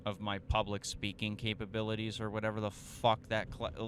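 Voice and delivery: ordinary delivery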